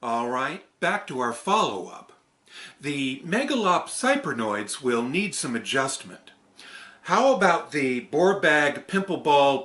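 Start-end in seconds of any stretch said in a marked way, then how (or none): 0.0-6.3 raised, louder character voice; loud, higher puppet-character delivery; character read. Acted. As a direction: perform the script line in a bright character voice. Performing a puppet character's script.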